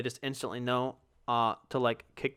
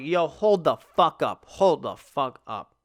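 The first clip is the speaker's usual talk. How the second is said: outraged disbelief; 6 dB louder, slower than usual. Retold or imitated. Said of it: "And I'm like".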